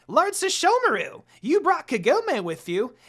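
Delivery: very high, loud fussy voice; squeaky demon servant. A narrator's reading. Jokin questioning his lord, high and loud. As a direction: squawk it like a fussing imp.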